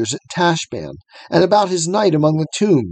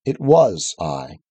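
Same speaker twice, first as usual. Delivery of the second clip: large deep voice; 8 semitones lower, slower than usual. A narrator's reading. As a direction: settle it low and steady. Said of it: "but very large and deep".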